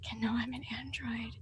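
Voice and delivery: very soft, confiding whisper; hushed secret. Acted. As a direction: drop almost to a whisper.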